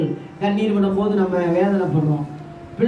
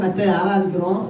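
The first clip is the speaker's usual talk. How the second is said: ordinary talk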